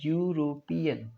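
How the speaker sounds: यूरोपियन said slowly, starting with a clear य; slow careful यूरोपियन with a y onset; pronouncing European. Shown as a demonstration. Teacher models यूरोपियन slowly for students. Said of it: "क्या है?".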